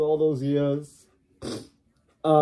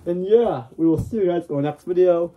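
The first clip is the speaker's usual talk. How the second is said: normal talking voice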